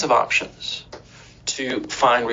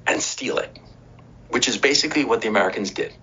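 ordinary talk

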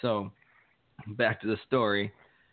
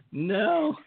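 laughing no. Retold or imitated. His own blunt answer, laughing.

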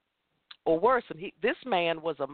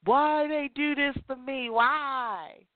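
higher, hammed-up whining cry; mock pity-party wail; 5 semitones higher, slower than usual. Mocking the victim she refuses to be, whiny high wail. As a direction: wail like someone sulking in self-pity. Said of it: "in my pity party boat".

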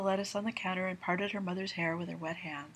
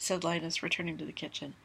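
ordinary delivery, nothing marked

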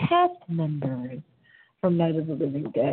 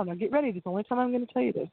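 rushed teaser; 2 semitones higher, much faster than usual. Real, not acted. Hurried teaser aside.

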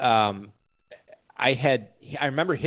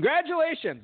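much higher, cheery doctor's announcement; bright congratulations; 13 semitones higher than usual. Retold or imitated. Doctor announcing the fracture, mock cheery. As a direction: sell it like a prize winner. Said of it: "She goes".

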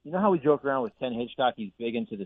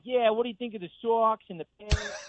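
a higher, hammed-up Boston accent; bad Boston accent; 10 semitones higher than usual. Paul Revere reenactor talks sports to the reporters, hammy Boston accent. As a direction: ham up a Boston sports fan's accent, pitched up. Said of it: "that's a bad accent".

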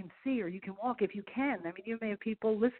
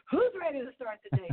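higher-pitched mock rallying call; retold yell; 5 semitones higher than usual. Golfer re-enacting her morning wake-up yell, a mild mock yell. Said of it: "I get up and I yell".

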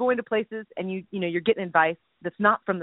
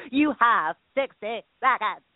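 slow put-on British producer; mock producer; slower than usual. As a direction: stretch out the countdown like the producer. Mocking the producer.

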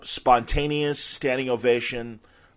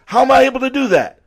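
much higher, louder and fast mock wonder; hammed-up wonder; 12 semitones higher, 9 dB louder, faster than usual. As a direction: ham up the magician's puzzlement. Ali teasing during levitation trick.

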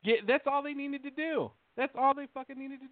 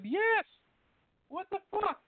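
much higher, incredulous outburst; exasperated; 9 semitones higher, slower than usual. Retold or imitated. Patient retelling his answer to the nurse practitioner, exasperated. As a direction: blurt it out in exasperated disbelief.

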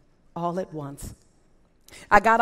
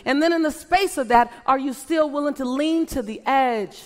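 louder, rising preacher's call, each question hammered home; rally the crowd to step up, question after question; fervent preaching; 6 semitones higher, 7 dB louder than usual. Acted. Speaker challenging the audience to chase their dream, fervent preaching.

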